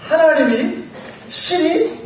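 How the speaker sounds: ordinary talk, nothing marked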